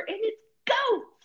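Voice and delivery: high, slow, squealing delight; thrilled goat discovery. Real, not acted.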